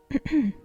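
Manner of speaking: short, deliberate throat clear. Acted. Teacher turning to address her pupil, clearing her throat. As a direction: clear the throat before a pronouncement.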